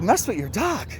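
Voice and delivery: high-pitched, disbelieving question; incredulous echo. Retold or imitated. Accused walker answering the dog's owner, high and incredulous. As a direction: throw the accusation back in mock disbelief. Acted baffled reply to accusation.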